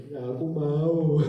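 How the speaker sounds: imitated acceptance. Imitates tearful acceptance of proposal, comically.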